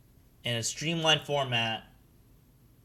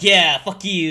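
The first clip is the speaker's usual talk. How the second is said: loud, higher jeering yell; jeering crowd; 5 semitones higher, 11 dB louder than usual. Acted. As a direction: cheer and taunt like a rowdy crew. Crew jeers after the club hit.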